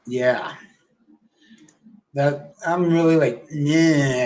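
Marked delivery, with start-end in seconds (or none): 3.5-4.2 drawn-out, grumbling "nah"; pissy nah. Real, not acted. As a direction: voice displeasure with a dragged-out nah. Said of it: "I'm all pissy about that".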